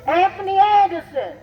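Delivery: loud calling names. Real, not acted.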